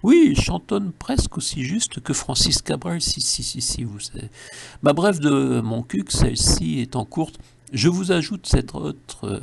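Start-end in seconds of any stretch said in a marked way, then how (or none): 3.0-4.3 hushed aside. Acted. Mock-confiding aside.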